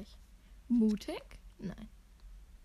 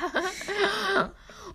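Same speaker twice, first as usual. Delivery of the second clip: burst of laughter; 9 semitones higher, 4 dB louder than usual. Real, not acted. Loud laugh.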